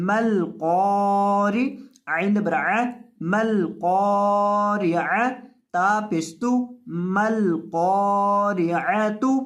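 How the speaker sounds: मल क़ारिअतु built up syllable by syllable, slow; slow spelling recitation. Shown as a demonstration. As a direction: recite each piece slowly, rising. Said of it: "रॉ दे री". (धीमी तिलावत, हिस्सा-हिस्सा)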